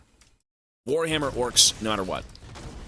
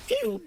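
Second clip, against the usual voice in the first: bow pew. Pretend. Mimicking a tiny bow shot.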